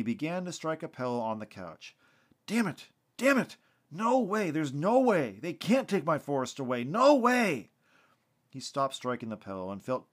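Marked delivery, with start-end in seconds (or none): 2.5-7.7 loud, high shouts of disbelief; enraged shouting; furious outburst. A narrator's reading. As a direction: explode in angry protest, punching out each phrase. Cussing while punching a pillow.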